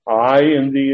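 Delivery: "I" hit loud and held; emphatic I. Shown as a demonstration. Preacher showing the verse's emphatic pronoun, stressed "I". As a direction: lean hard on the word "I". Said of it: "is emphatic in verse twenty-one".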